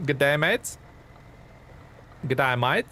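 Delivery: light Aussie twang, rising at the end; amateur Australian accent with upward lilt; attempted Australian accent. Shown as a demonstration. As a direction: try an Australian "g'day, mate". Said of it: "attempt at Australian accent".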